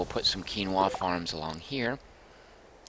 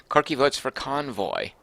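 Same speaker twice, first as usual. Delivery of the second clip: normal talking voice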